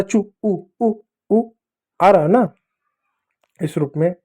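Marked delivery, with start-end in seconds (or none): 0.0-1.4 चू followed by repeated, separate उ sounds; vowel isolation demo. Shown as a demonstration. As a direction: echo the "oo" of चू as उ, higher pitched. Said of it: "उ भी आ रहा है".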